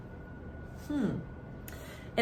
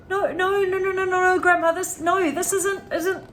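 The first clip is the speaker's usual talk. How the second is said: high, louder frantic stammering no's; panicked boy; 11 semitones higher, 6 dB louder than usual. A narrator's reading. George frantically protesting to Grandma, high and panicked.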